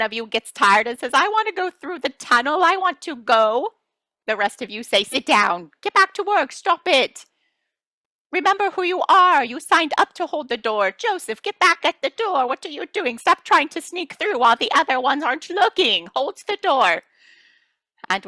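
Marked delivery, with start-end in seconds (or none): 1.1-3.7 high, whining plea; a tired child begging to go; pleading child. Pretend. Tired soul pleading to leave. 5.0-17.0 high, bossy scolding; a coworker scolding a slacker to get back to work. Pretend. Others scolding the tired soul.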